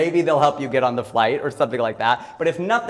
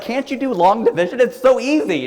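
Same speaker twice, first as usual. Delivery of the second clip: much higher and louder, a mock patronizing tone; mock condescension; 12 semitones higher, 9 dB louder than usual. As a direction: talk down to someone who can't do long division.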